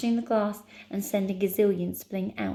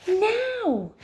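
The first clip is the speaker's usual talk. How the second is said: very high, loud drawn cry; urgent shout; 14 semitones higher, 5 dB louder than usual. Acted. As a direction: shout it with all your force. Heroine shouts while pushing.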